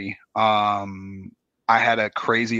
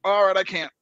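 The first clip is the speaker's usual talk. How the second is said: pained cry; 9 semitones higher, 3 dB louder than usual. Retold or imitated. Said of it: "And like".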